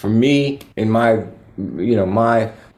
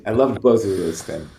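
normal talking voice

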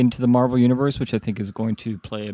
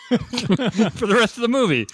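laughing; 11 semitones higher, slower than usual. Real, not acted. Laughing at own joke.

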